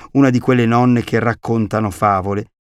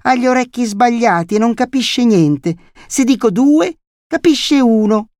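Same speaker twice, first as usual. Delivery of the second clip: put-on very high-pitched child's chatter; little boy showing off; 12 semitones higher, 4 dB louder than usual. A narrator's reading. Boy describing deaf grandmother to lady, childish showing off. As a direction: show off in a small boy's voice. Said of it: "egli faceva sfoggio di esempi numerici".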